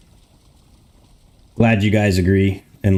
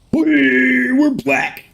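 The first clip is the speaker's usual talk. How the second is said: high squealing whee, playful; mock cheer; much slower than usual. Green screen turned off, mock celebration.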